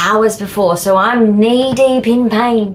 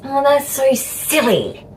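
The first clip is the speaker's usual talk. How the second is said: higher, drawn-out scoff; mock dismissal; 7 semitones higher than usual. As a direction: imitate someone brushing it off as nonsense. Voices others dismissing the aversion.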